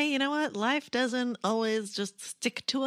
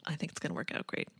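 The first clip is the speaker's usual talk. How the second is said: whispering; 7 semitones lower, 7 dB quieter, faster than usual. Real, not acted. Reassuring aside, unvoiced.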